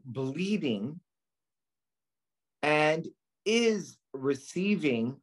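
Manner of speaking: slow, careful dictation for notes; dictating a board question slowly. Shown as a demonstration. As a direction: read it out slowly so they can write. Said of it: "Write this down. Ready?".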